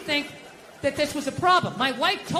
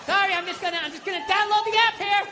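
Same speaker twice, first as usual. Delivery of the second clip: strained apology; 8 semitones higher than usual. Acted. Dad apologises while keeping frustration in.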